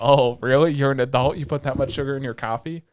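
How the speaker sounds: loud, teasing disbelief; loud mock-challenge, easing off by the end; mock incredulity. Mocks a character's claim to adulthood.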